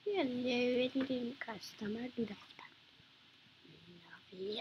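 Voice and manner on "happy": breathy whispered take, voice dropped low and soft. Shown as a demonstration. Streamer testing the word to a game customer, whispered. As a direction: say it under your breath.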